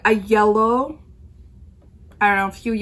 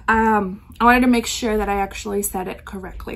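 ordinary delivery

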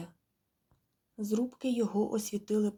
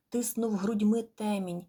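ordinary delivery, nothing marked

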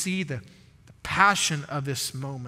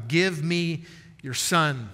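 slow, weighty quote; much slower than usual. Shown as a demonstration. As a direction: Give drawn out slowly, each word set apart. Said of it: "underline that simple phrase".

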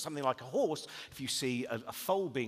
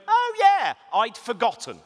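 put-on breezy kid voice; 8 semitones higher, 13 dB louder, slower than usual. Child's casual reply.

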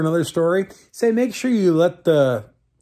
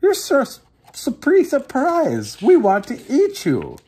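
high, startled voice; surprised old woman; 11 semitones higher than usual. Acted. Old woman chasing the gingerbread man, high and surprised. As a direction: sound caught off guard and flustered.

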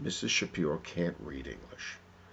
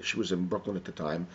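ordinary delivery, nothing marked

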